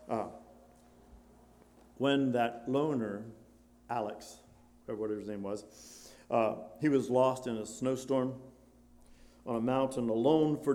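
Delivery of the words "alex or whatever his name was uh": ordinary delivery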